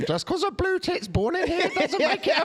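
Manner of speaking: very high, laughing through the line; laughing mock voice. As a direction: squeal it while cracking up.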